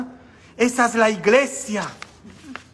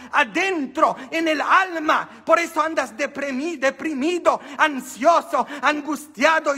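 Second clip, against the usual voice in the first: raised, ringing preaching voice; preaching hard; 8 semitones higher than usual. Acted. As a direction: call it out to the congregation. Preaching about the Eucharist.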